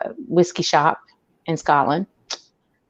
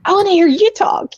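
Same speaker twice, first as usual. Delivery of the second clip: high, bright mock plea; playful high-voiced protest to the twins; high playful reply; 14 semitones higher, 3 dB louder than usual. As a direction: pitch it way up, teasing back. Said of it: "And I'm like".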